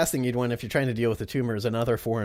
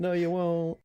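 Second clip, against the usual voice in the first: smug villain retort; 6 semitones higher, slower than usual. Acted. Villain retorts to his nemesis.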